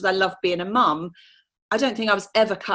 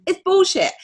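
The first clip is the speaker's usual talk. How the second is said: much higher and louder, scoffing; blunt dismissal; 11 semitones higher, 5 dB louder than usual. Retold or imitated. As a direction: shoot the excuse down hard. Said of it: "I'm like".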